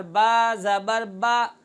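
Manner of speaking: बा said with the mouth wide open, a long open aa; slow open aa vowel on बा; open-mouth zabar. Shown as a demonstration. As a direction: open the mouth fully on बा ज़बर बा. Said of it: "इसको खोल कर".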